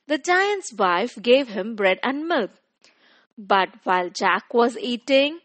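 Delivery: ordinary delivery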